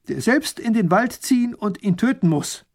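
high, slow proclaiming; decree. Acted. Grand duke dictating to the scribe, proclaiming. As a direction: proclaim it aloud. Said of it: "murmelt dabei leise".

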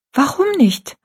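put-on high, bewildered child's question; confused girl. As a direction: she doesn't understand, asking high. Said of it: "fragte Rosalie verwirrt".

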